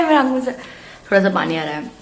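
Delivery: talking through a burning mouth; strained from chilli heat; mouth burning from spicy food. Real, not acted. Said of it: "ये सच में बहुत तीखा है".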